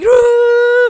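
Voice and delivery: loud, high mock bawling; drawn-out pretend wail; imitated child's crying. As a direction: imitate a child who can't stop crying. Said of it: "she couldn't stop crying".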